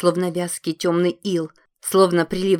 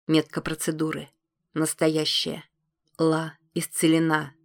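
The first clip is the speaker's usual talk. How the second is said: ordinary delivery, nothing marked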